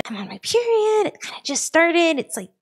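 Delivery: high-pitched, sheepish confession; acted-out awkward admission; embarrassed apology.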